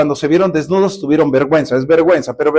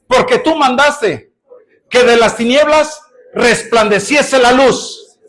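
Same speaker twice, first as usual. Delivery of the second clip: rising, louder preaching prayer; fervent prayer; 5 semitones higher, 4 dB louder than usual. Acted. Pastor praying aloud before the congregation, rising in fervor. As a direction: build to a higher, ringing plea.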